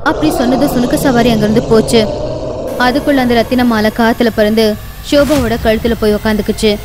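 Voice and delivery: usual speaking voice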